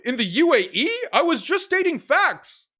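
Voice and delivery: high, drawn-out parody of a smug opponent; mock-innocent debater voice; mocking imitation. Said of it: "he was like".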